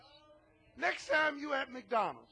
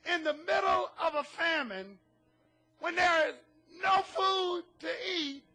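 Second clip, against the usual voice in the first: ordinary talk, nothing marked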